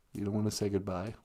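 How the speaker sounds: deep fast put-on voice; low put-on voice. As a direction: drop into a low, quick comic voice.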